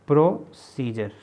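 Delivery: "Procedure" slowly split as pro, then scissor; syllable by syllable. Shown as a demonstration. Teacher writing the word out for students, "procedure" slowly broken up. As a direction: say "procedure" in two separated parts. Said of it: "ठीक है जी".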